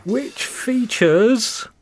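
very slow, drawn-out teaser; suspenseful build-up. Pretend. Host teasing this month's story title, drawn-out suspense. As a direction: stretch it out to tease the reveal.

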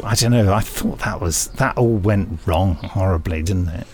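ordinary delivery

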